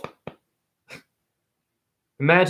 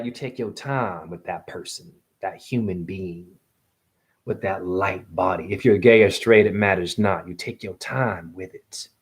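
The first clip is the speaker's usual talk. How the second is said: romantic voice; 6 semitones lower than usual. Pretend. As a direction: talk low and intimate, as if to a lover. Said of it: "I'm gonna use my romantic voice".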